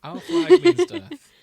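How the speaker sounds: a hammed-up presenter introducing the show; grand announcer. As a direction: boom it out like a trailer voice. Grandly introducing the show's title.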